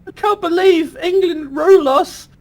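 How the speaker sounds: high, put-upon colonial voice; voicing America as a whiny teen; mock whiny America. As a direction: whine it like a sulky colony.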